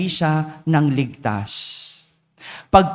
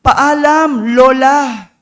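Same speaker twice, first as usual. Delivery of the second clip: shouted farewell; 6 semitones higher, 6 dB louder, much slower than usual. Acted. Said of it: "Ang sigaw niya".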